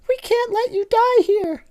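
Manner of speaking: very high squeaky plea; toy-voice desperation; pleading Lala. Acted. Lala pleading with Tinky Winky, very high.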